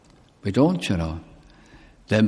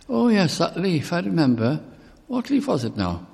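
forgetful mourner; 8 semitones higher than usual. Retold or imitated.

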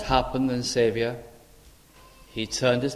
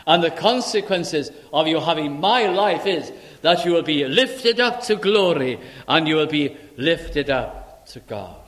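loud, high, rising proclamation; full-force preaching; 4 semitones higher, 4 dB louder than usual. Acted. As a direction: preach it to the back of the hall. Promise of glory.

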